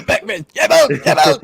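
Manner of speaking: manic mock co-host voice, loud at the end; hammed-up catchphrase impersonation; Trent. Podcaster showing how his co-host sounds, manic impersonation. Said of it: "that's how he sounds".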